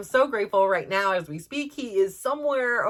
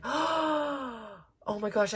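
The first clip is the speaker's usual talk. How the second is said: high, soft, long-drawn breath of amazement; gasp, then stretch it out softly; retold awed gasp; 4 semitones higher, 5 dB quieter, much slower than usual. Mom realizing he may board, gasping amazement. Gasps as the door closes.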